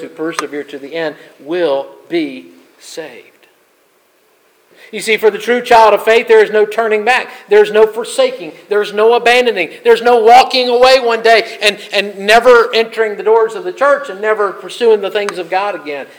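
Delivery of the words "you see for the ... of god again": loud, higher, driving sermon delivery; full-force preaching. Acted. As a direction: preach it out to the whole church. Preacher builds to a peak.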